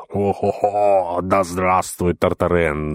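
slow, drawn-out howling cheer. Acted. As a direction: howl it out. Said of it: "завывал экс-курбаньес".

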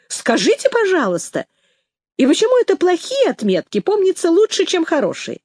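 high, merry mock wonder; cheerful amazement. A narrator's reading. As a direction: keep the pitch up, playfully astonished. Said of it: "весело изумился Ромка".

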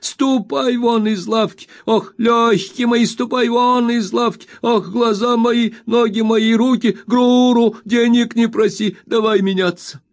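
put-on high, slow, whining old miser's wail; spiteful whining old man. A narrator's reading. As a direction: whine it out spitefully like a crazed old junk dealer. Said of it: "произносил нараспев".

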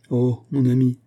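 a touch of an outcry; exclaiming. A narrator's reading. Ladov confesses to his friend, exclaiming. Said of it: "s'écria Ladov".

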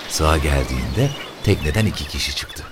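plain reading voice

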